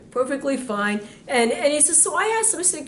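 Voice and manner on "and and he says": usual speaking voice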